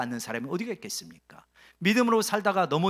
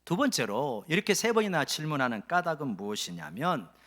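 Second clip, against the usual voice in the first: ordinary talk, nothing marked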